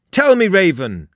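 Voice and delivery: high, loud summons; imperious high character voice; commanding character. A narrator's reading. Frost addresses the raven.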